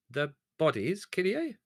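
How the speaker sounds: high, hesitant question; tentative query. Acted. The turkey owner, caught out, asks back: high and hesitant. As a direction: ask it warily, stalling. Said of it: "queried the turkey owner tentatively".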